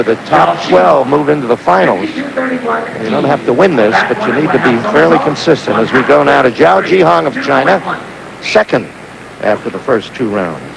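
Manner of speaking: normal talking voice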